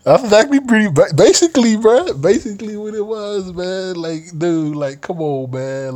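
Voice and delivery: high-pitched, laughing so hard while talking. Real, not acted. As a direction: squeeze the words out through laughter, settling gradually. Talking through helpless laughter.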